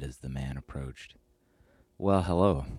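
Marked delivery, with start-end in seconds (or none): 2.0-2.6 bright cheerful hello, a bit higher. A narrator's reading. Lucilius greeting his angry friend, cheerful. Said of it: "Lucilius said cheerfully".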